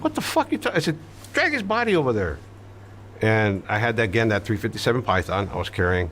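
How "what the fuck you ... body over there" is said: angry order. Retold or imitated. Ordering frozen accomplice.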